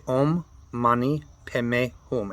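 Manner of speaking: slow "Om Mani Padme Hum" with Padme said as "Peme"; Tibetan pronunciation. Shown as a demonstration. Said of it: "They pronounce it Peme".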